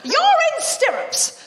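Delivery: dramatic scene-setting, high and slow; high drawn-out. Acted. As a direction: drawl the setup up high for comic effect. Comic setup drawn out.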